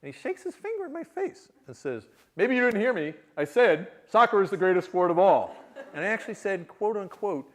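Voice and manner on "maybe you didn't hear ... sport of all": loud, emphatic confrontation; acted-out forceful insistence; laser-direct challenge. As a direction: drive it home loudly, right in his face. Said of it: "real laser direct, this guy".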